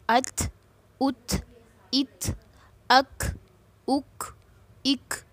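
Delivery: अथ, उथ, इथ, अख, उख, इख each stopped on t or k, then a puff of air; hams drill. Shown as a demonstration. Teacher drilling the hams rule for students, aspirated endings from अथ to इख. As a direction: separate syllables, breath escaping after the final stop of अथ through इख. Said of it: "हम्स कहते हैं".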